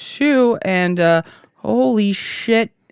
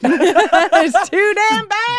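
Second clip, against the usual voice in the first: slow, high, laughing drawl; 13 semitones higher, much slower than usual. Real, not acted. Joking regret.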